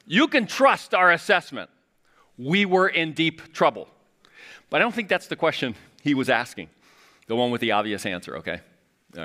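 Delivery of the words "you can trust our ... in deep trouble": slower, a bit higher insistent fisherman; lightly voiced Peter, slow and emphatic; Peter from The Chosen. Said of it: "I see Peter on The Chosen".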